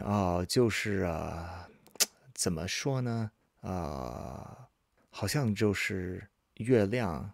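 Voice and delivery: halting, pensive self-talk; thinking aloud. Acted. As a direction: mull it over to yourself, then offer a tentative answer. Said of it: "Like they're talking to themselves".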